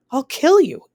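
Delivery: high, loud furious shriek. Acted. Anne attacking the narrator, furious shriek. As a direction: scream the threat at her. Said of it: "Anne shrieks".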